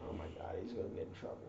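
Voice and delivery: fast, breathless whisper; recruit's panicked inner whisper; whispered inner thought. Retold or imitated. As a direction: whisper it to yourself in alarm. Inner thought while watching.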